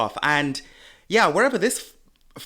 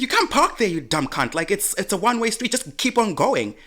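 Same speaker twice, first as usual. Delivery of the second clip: yelling at driver; 7 semitones higher than usual. Retold or imitated. Telling the driver off, raised retold yell. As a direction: snap back at the driver.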